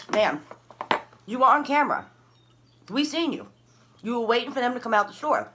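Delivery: loud, high-pitched retold accusation; accusing officer. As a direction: play an officer insistently confronting a suspect. Acts out officer accusing her.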